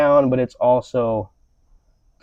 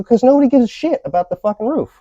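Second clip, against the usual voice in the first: exasperated retort; 11 semitones higher than usual. Retold or imitated. Retold reply to the boss.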